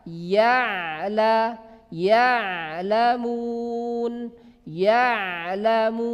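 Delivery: ya'lamun slowly with the ain flowing on; unbroken ya'lamun. Shown as a demonstration. Teacher shows ain sukun flowing. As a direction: stretch ya'lamun without cutting the ain. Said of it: "Jangan diputus".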